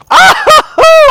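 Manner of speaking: loud high burst of laughter; delighted laugh. Real, not acted. Laughing as the dog leaps into frame, high and loud.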